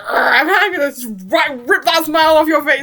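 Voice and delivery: mock threat. Voicing Adrian threatening Kim.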